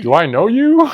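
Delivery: high, loud stunned question; incredulous. Retold or imitated. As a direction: blurt it out baffled. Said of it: "Who are you?".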